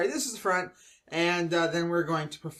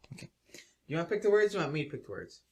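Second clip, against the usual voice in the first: usual speaking voice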